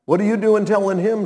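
ordinary talk, nothing marked

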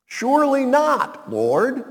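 mock Peter protest. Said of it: "Peter ... began to rebuke".

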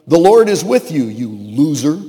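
mock insult. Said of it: "God could have said to him".

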